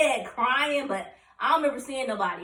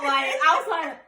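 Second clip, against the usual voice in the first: ordinary talk, nothing marked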